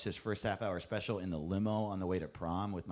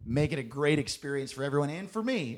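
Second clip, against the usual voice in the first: ordinary delivery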